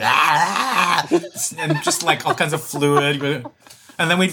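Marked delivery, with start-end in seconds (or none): 0.0-1.1 snotty crying. Retold or imitated. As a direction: wail like a big ugly cry. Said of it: "have your biggest ugly cry be like".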